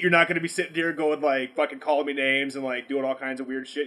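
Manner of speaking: ordinary delivery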